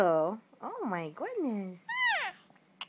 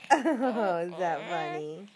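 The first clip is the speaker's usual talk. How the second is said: ordinary talk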